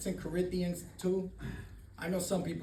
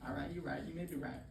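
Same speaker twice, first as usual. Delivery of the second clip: much softer, reluctant concession; grudging mumble; 5 semitones lower, 12 dB quieter than usual. Retold or imitated. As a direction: give in under your breath. Grudgingly giving in to sponsor.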